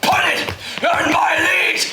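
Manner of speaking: high-pitched threatening yell at a dog; shouting at dog. Pretend. Owner threatening his dog over the lead, exasperated shout.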